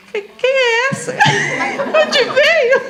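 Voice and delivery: high, laughing mock shout; Claudinha's surprised shout. Friend Claudinha reacting to a flashy newcomer, laughing shout. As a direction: play the friend's amused surprise. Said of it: "a Claudinha falou".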